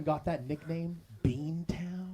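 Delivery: breathy whispered punchline; conspiratorial whisper; whispered aside. Acted. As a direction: lean in and whisper the name. Roast joke aside whisper.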